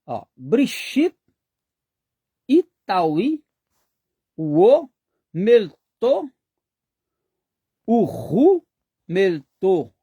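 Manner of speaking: slow, deliberate Aramaic words with pauses between; slow Aramaic reading. Shown as a demonstration. Teacher reads the same verse in the other script, slow and careful. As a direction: spell the sounds out for the students. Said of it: "É a mesma pronúncia".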